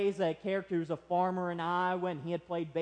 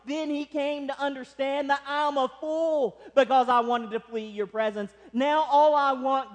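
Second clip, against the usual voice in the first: raised, driving sermon delivery; impassioned preaching; 5 semitones higher, 5 dB louder than usual. Real, not acted. Preacher telling congregation of Jonah fleeing God, impassioned preaching. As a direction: preach it with mounting urgency.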